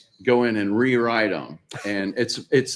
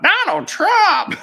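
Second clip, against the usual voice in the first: loud, high screech of a mock politician; mock her voice, pitched up and loud; mocking Hillary Clinton; 8 dB louder, much slower than usual. Mocking a politician's aggravating voice, mock screech. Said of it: "I was mocking her".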